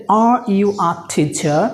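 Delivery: very slow, carefully spaced words; dictation-slow teacher read; slow model sentence. Shown as a demonstration. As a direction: say it slowly so learners can follow. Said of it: "teacher models the English sentence".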